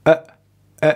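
brief neutral "uh" for "ا"; isolated schwa. Shown as a demonstration. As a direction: drop out a short, relaxed "ا".

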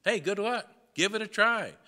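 high, loud mocking "good luck"; sarcastic. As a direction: toss it off with sneering sarcasm.

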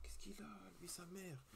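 soft unvoiced whisper; whispered aside. Real, not acted. Vlogger whispering about someone nearby, hushed. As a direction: lean in and whisper about him.

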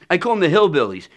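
ordinary talk, nothing marked

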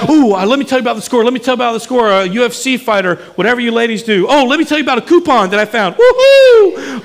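fast, high, gushing excitement ending in a whoop; football fan excitement. Retold or imitated. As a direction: bubble over like a fan at a game. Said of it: "we're like".

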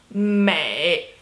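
美 drawn out low and dipping; third tone. Shown as a demonstration. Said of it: "Third tone".

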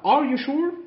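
surprised mum. Acted. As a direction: ask in mild surprise. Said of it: "قالت لها".

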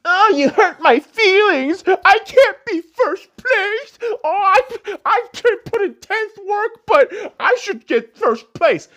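high, slow, mock-sobbing whine; whiny crybaby. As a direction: whimper it like a spoiled child. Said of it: "so fucking soft".

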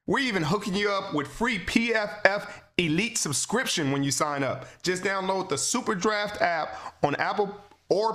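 brisk sponsor read in the host's own voice; smooth host-read commercial. Acted. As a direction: read the promo like a podcast ad spot.

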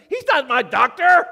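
high, loud panicked yelp; comic panic. Acted. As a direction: cry out as the horrified patient, hammed-up. Patient in joke panics.

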